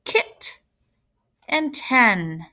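"kit" and "ten" as isolated pieces; syllables said separately. Shown as a demonstration. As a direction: sound out "kit", pause, then "ten". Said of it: "we have two syllables".

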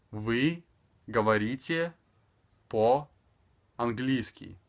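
"Вы говорите по-английски" one word at a time with long pauses; slow, spaced-out teaching delivery; extremely slow. Shown as a demonstration. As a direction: stretch each word for a learner. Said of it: "speaking extremely slower".

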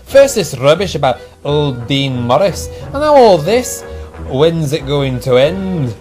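huffy complaint. A narrator's reading. Engine grumbles about the ghost stories, huffily. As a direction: complain with mounting exasperation. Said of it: "puffed Donald".

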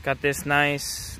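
normal talking voice

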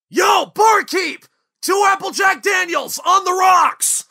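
high, loud shout across the bar; calling the barkeep. Acted. Calling over the bar to order drinks, loud shout. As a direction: yell the order over the noise.

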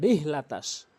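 রিহ with a light small h; common mispronunciation. Shown as a demonstration. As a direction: say রিহ with a weak h. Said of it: "অধিকাংশ লোক".

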